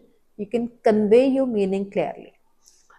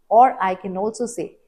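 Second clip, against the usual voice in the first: ordinary delivery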